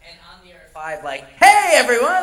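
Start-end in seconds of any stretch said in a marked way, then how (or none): 1.4-2.2 much higher and louder, bursting with morning cheer; peppy radio host. Shown as a demonstration. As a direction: fire up an over-the-top wake-up greeting. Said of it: "Like that".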